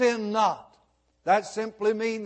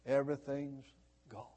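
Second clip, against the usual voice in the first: low, hushed despair, trailing away; retold tearful loss; 7 semitones lower, 12 dB quieter, faster than usual. Robbed man confides in his pastor, quietly despairing. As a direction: let it sink softly into loss. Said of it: "through his tears, he said".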